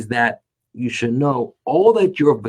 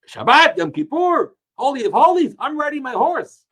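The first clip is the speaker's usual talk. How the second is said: defiant declaration; 11 semitones higher than usual. Retold or imitated. Rabbi voicing Elisha's defiance on Yom Kippur, defiant and high. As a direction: proclaim it like a rebel showing off. Acher's defiant statement voiced.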